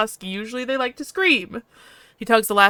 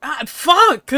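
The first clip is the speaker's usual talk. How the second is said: loud higher cry of pain; 7 semitones higher, 7 dB louder than usual. Acted. Alcohol on the open wound, a loud pained curse. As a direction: curse through the burning pain.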